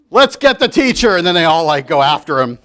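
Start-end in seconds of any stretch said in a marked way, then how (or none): 0.1-1.2 higher, louder rallying cry; mob of kids. Acted. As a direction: kids egging each other on. Kids turn on teacher.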